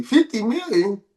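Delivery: ordinary talk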